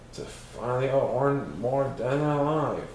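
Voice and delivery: usual speaking voice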